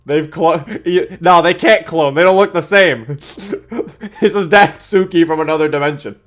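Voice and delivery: high, loud, laughing disbelief; real giddy excitement; excited realization. Real, not acted. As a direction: blurt it out loudly between laughs. Excitedly working out the twist.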